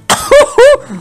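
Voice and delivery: high-pitched whooping laugh; surprised laugh. Real, not acted. Gamer startled by the next move, high surprised laugh. Reacting to the bonus attack.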